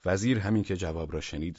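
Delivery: usual speaking voice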